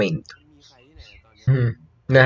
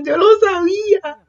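much higher, cracking cry of triumph; crying with joy. Real, not acted. Fan crying that she knew it, high cracking voice. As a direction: cry out, told you so.

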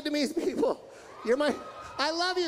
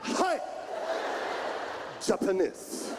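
abrupt mock Japanese-accented syllables; Japanese man accent; 3 semitones lower, 4 dB quieter, slower than usual. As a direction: fire out single words like a caricatured Japanese man. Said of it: "When a Japanese man talks".

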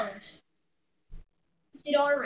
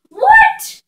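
shocked shriek; 16 dB louder than usual. Real, not acted. Surprised at the dice.

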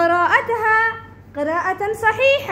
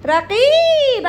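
رقيب drawn out slowly and a little louder; slow, stretched reading; 3 semitones higher, 3 dB louder, much slower than usual. Shown as a demonstration. Teacher repeating the word for the class, slow and stretched. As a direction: say رقيب slowly, leaning on the long ي. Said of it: "صوت طويل أو قصير؟".

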